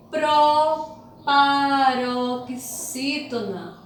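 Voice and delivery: proparoxítona very slow, pro held apart from the rest; syllable by syllable. Shown as a demonstration. Teacher naming the new term for pupils, proparoxítona slowly sounded out. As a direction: stretch out proparoxítona in separate pieces.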